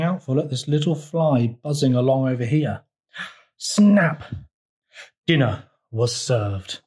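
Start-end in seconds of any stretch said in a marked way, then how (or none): 3.6-4.2 higher-pitched, sudden "snap!"; sharp exclaimed snap. A narrator's reading. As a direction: punch it out like a sound effect.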